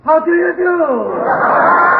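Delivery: slow, drawn-out haunted-house greeting; spooky caretaker greeting. Acted. Creepy caretaker greeting the startled visitor, drawn out slowly. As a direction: stretch it out like a creepy butler.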